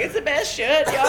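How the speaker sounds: high laughing mock brag; imitating Legacy. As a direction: pitch way up, proud of the shirt. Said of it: "He's like".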